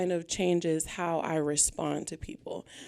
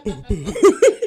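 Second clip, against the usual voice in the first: laughing; 11 dB louder than usual. Real, not acted. Laughing at heartbeat on mic.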